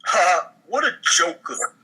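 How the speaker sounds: ordinary talk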